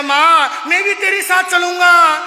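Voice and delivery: put-on high, loud insisting boy; stubborn child. Son insisting to mother, high and loud. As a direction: demand it like a whining kid.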